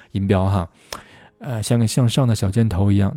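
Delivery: usual speaking voice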